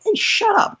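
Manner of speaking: high-pitched imitated telling-off; mock shushing. Heckler's friends shutting him down, high mock scolding. Voices audience silencing a heckler.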